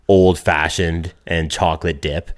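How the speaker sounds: usual speaking voice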